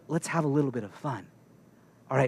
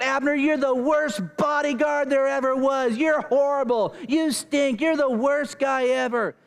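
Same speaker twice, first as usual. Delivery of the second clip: high, hammed-up shouted insults; taunting yell held up at a high pitch; 7 semitones higher than usual. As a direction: holler mocking insults at someone far off. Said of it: "[name] yells out".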